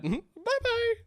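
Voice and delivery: very high, laughing mock farewell "Bye-bye"; a silly falsetto "Bye-bye" to the player who betrayed him; squeaky high bye-bye. Mock sad farewell after sacrifice.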